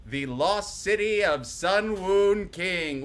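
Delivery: big booming reveal of the city; grand announcement. Acted. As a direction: announce it like a movie trailer.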